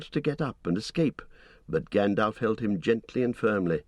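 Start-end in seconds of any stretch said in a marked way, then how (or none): none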